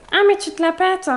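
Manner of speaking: high-pitched mocking jeer; hammed-up high accusing voice; mock accusation. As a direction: imitate someone calling out a show-off. Mocking quote of accusing listener.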